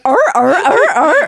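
loud barking walrus grunts. Pretend. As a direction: bark like a walrus. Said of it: "I was gonna be like".

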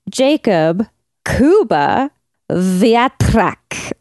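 slow, Wiatrak with a rolled trilled r. Shown as a demonstration. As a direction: roll the r in Wiatrak hard. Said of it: "the three rolled R's as requested".

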